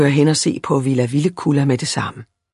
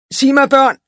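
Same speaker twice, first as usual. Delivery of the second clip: put-on higher, louder narrated shout to children; calling out; 8 semitones higher, 5 dB louder than usual. A narrator's reading. Fine gentleman hailing children at a garden, calling out. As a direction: call out to get their attention. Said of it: "råbte han".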